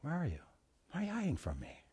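very soft, tender questioning; God's gentle voice. Acted. Preacher voicing God to the congregation, hushed and searching. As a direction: ask it hushed, almost under the breath. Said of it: "God's voice this morning saying to you".

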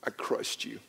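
hushed whisper of remorse; whispered apology, unvoiced; retold whispered confession. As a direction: breathe it out, barely a whisper. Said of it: "I called her name and I said".

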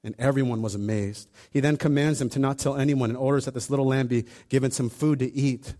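ordinary talk

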